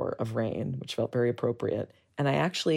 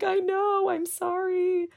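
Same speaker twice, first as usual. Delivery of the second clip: much higher, tearful pleading voice; sobbing apology. Retold or imitated. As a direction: blurt it out through retold tears, pitch way up. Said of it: "I just was sobbing".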